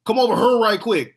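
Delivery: put-on "here" as "hur", high and loud Saint Louis accent. Imitating most people in Saint Louis, exaggerated local accent. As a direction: call out in a broad Saint Louis accent, "here" rhyming with her. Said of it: "most people in Saint Louis would be like".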